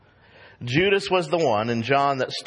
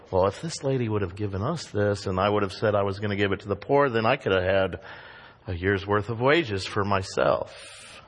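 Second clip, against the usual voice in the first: lower, softer, quick scheming aside; scheming Judas; 7 semitones lower, 3 dB quieter than usual. Retold or imitated. Preacher voicing Judas's greedy private thoughts, sly. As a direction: muse greedily under your breath. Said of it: "what Judas was thinking".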